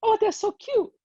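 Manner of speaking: hammed-up high squeal of delight; mock swooning. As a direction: gush like a charmed clerk, way up high. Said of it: "And she goes".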